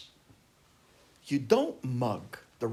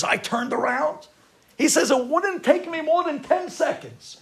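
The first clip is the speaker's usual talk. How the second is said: loud, high, drawn-out bragging; triumphant boast; 11 semitones higher, 8 dB louder, slower than usual. Retold or imitated. Son telling how he beat muggers, loud triumphant boast. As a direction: punch out the victory slowly at high pitch.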